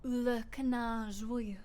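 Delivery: slow, careful French on Le Canard Joyeux; French pronunciation. Acted. Player reading the tavern name, careful French Le Canard Joyeux.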